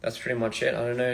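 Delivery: ordinary talk, nothing marked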